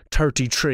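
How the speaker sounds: "thirty-three" with hard T's, like "turty tree"; "thirty-three" with every TH hardened to T; Irish TH as T. Shown as a demonstration. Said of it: "not all Irish people say".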